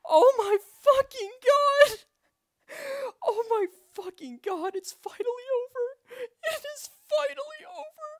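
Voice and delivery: high, tearful sobbing relief; crying with relief. Real, not acted. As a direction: break into tears of joy. Overwhelmed after beating the run.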